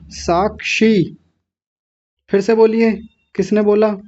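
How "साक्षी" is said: साक्षी drawn out slowly, each sound clear; slow careful saakshi. Shown as a demonstration. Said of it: "पहला दंत्य सकार है". (धीरे धीरे साफ़ उच्चारण)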